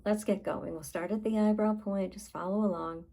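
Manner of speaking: ordinary talk, nothing marked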